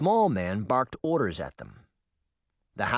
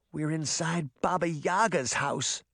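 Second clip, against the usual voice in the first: croaked; 4 semitones higher than usual. A narrator's reading. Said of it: "Sabrina croaked".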